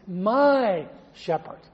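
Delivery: MY slow and leaned on, shepherd trailing off; emphasis on my, said slowly; stress on my. Shown as a demonstration. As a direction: drag out my shepherd, punching my. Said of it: "But the emphasis".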